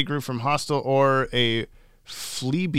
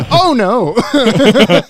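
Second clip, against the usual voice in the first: mock fear; 10 semitones higher, 12 dB louder, much slower than usual.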